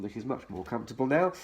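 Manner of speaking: ordinary delivery, nothing marked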